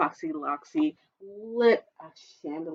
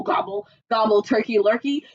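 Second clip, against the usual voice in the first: ordinary delivery, nothing marked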